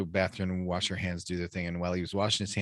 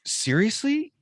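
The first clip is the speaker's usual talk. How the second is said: very high incredulous seriously; incredulous friend in retold story; disbelief; 5 dB louder, slower than usual. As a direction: squeak out stunned disbelief.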